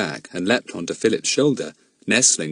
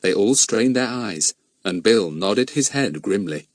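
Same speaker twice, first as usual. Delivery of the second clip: ordinary delivery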